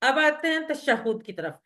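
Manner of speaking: normal talking voice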